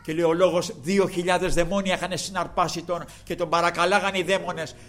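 ordinary delivery

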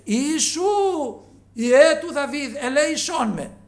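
loud, high, slow pleading cry. Retold or imitated. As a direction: drag out each word, begging to be heard. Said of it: "πολλό μάλλον έκραζε".

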